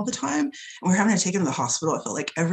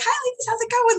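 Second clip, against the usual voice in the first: very high, sing-out customer greeting; chirpy greeting. Retold or imitated. Acting her shop greeting.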